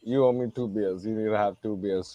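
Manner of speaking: ordinary talk, nothing marked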